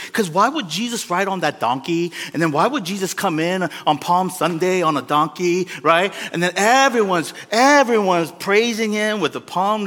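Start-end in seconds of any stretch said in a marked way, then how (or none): none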